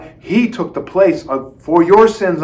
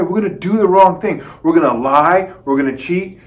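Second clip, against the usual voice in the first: ordinary talk, nothing marked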